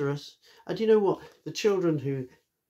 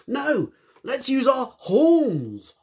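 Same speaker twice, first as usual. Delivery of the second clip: much higher, louder, slow holler; hollering rhino; 13 semitones higher, 9 dB louder, much slower than usual. Acted. Rhino to the animals, a slow loud holler. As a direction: holler it across the plain. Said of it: "hollered the Rhino".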